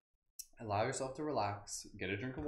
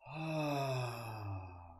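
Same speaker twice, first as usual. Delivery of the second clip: long audible sighing exhale; relaxed, drawn-out "ahh" breath; big exhale. Shown as a demonstration. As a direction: let the breath fall out in a slow sigh. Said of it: "Nice big exhale".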